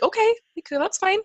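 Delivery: high, sing-song mock agreement; high mock okay. Mom imitating her high sweet reply to her toddler. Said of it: "I was like".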